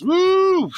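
sharp high whoop of alarm; high loud woo. Real, not acted. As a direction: let out a high startled woo. Reacting to the word obedience.

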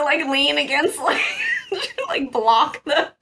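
usual speaking voice

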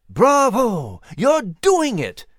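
high, drawn-out jubilant cheer. A narrator's reading. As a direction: cheer them on, stretching the words. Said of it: "Nigel cheered".

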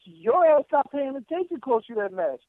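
high-pitched incredulous argument; indignant scolding. Acted. Fan scolding wrestler for blaming Jay, indignant.

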